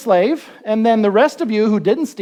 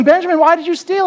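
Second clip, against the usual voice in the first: accusing brothers; 8 semitones higher, 5 dB louder than usual. Retold or imitated. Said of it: "they're probably going".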